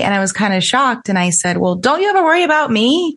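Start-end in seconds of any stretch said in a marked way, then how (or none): 1.6-3.1 light teasing. Retold or imitated. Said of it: "Trying to make light of the situation".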